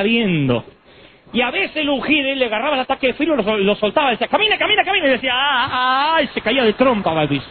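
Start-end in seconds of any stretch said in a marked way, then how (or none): 4.3-5.1 high, rapid-fire healer's command; hammed-up preacher egging someone to walk; mock urging. Retold faith healer urging a man on. 5.3-6.2 drawn-out "ah" of someone toppling; comic falling cry, pitched up; mock wail. Imitating the man before he falls.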